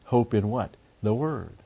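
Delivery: ordinary talk, nothing marked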